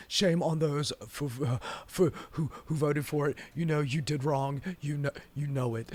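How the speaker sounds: low, soft, halting impression; badly faked billionaire, muttered and stumbling; Elon Musk. Host imitating billionaire scolding bill's voters, low and subdued. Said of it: "My Elon is not very good".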